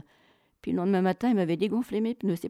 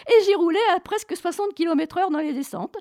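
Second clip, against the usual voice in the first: high, loud, laughing delivery; exhilarated, laugh still in the voice; 11 semitones higher, 6 dB louder than usual. Real, not acted. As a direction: tell it through laughter, amazed at your own daring. Laughing about her fast descent.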